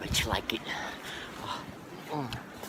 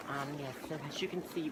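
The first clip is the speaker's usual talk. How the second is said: normal talking voice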